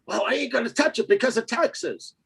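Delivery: mock whiny investor. Imitating timid investors.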